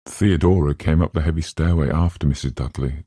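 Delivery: usual speaking voice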